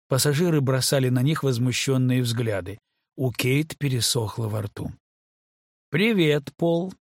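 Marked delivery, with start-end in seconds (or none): 5.9-6.9 bright high cheerful greeting; cheerful chirp. A narrator's reading. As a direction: chirp it sweetly with false cheer. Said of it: "весело прощебетала Люси".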